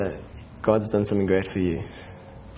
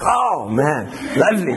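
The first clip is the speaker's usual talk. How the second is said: delighted exclamation; 3 semitones higher than usual. Retold or imitated. Imitating delighted reaction.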